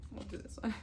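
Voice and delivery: hushed unvoiced whisper. Real, not acted. Whispering aside to viewers about the card. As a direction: drop to a secret whisper. Avoiding showing nudity on camera.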